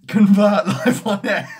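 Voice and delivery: loud, slow laughing cry, high up; laughing shout. Real, not acted. To his stream viewers, laughing loud and slow about converting live. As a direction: burst out laughing as you announce it.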